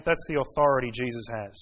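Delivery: usual speaking voice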